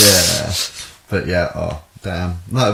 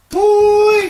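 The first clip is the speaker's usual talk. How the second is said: squeaky bye; 9 dB louder than usual. Pretend. Silly sign-off.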